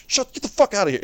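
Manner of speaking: high, outraged mock yell; mock outraged cashier. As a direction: snap it out in mock disbelief. Reacting to counting customer.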